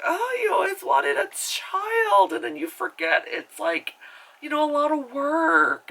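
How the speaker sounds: high, drawn-out mock lament; hammed-up mocking cry; mocking wail. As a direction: taunt in a squealed whine. Mocking the parent's wish.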